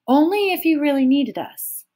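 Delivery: ordinary delivery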